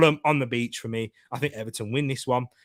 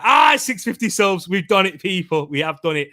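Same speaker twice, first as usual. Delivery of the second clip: loud, high cheer easing as it goes; excited celebration; 4 semitones higher, 7 dB louder than usual. Real, not acted. Streamer celebrating six fifty subs with viewers, excited shout. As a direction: burst out loudly, then settle into proud insistence.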